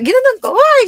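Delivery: high, loud scolding yell; tearful shouted confrontation. Retold or imitated. Mother yelling at daughter, retold.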